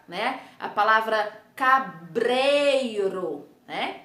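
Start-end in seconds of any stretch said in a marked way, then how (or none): 1.5-3.5 CABREIRO drawn out slowly as "ca-brei-ro"; slow teacher's model of CABREIRO; sounding out. Shown as a demonstration. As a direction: stretch CABREIRO so the tapped R is heard. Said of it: "Presta atenção aí no som das letras".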